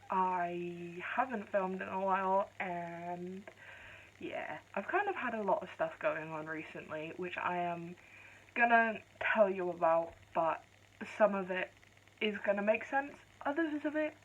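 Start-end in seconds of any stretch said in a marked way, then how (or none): none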